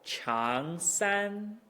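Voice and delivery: "Chang" rising to a high, level "san"; sustained high "san" after a rise; rise into first tone. Shown as a demonstration. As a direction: slide up on "Chang" and hold "san" there. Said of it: "second tone ends where the first tone starts".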